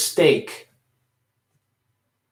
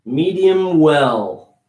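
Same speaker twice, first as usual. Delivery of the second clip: "medium well" said slowly with the M of "medium" held long; carried M; 3 dB louder, much slower than usual. Shown as a demonstration. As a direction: hold the M of "medium" before opening to the vowel. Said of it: "Carrying that M".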